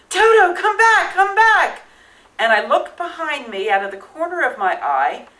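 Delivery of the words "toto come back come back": loud, high call after a runaway dog; yelling after the dog. Retold or imitated. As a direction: shout it across the theater shop. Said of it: "I hear somebody yell".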